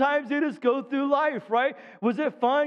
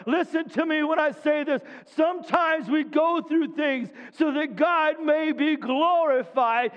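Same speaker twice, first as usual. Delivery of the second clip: preaching; 3 semitones higher than usual. Acted. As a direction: build it up for the congregation. Preacher quoting scripture, exhorting.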